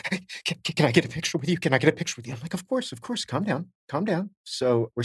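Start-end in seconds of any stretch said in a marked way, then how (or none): 0.0-2.3 breathless, gasping imitation of a starstruck girl; mock-panicked request, short of breath; hyperventilating fan. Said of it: "she's, like, hyperventilating". 2.5-4.3 soft, higher-pitched soothing; acted-out calming down, light and high; retold reassurance. Soothing a panicked fan, retold.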